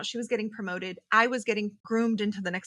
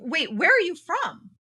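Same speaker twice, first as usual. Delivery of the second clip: sharp demand; 8 semitones higher, 8 dB louder than usual. Retold or imitated. Vendor demands her origin.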